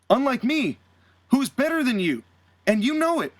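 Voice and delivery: mock-heel bragging; smug taunt. Acting MJF's taunting promo.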